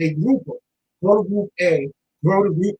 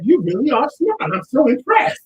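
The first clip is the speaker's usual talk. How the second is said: higher, delighted praise; impressed; 6 semitones higher than usual. Retold or imitated. Sister praising her daughter's grades, delighted. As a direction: gush over the report card. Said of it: "And she was like".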